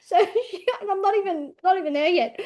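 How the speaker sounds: high, giggling correction; laughing aside. Real, not acted. Getting ahead of her story, laughing and high. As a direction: laugh while backtracking.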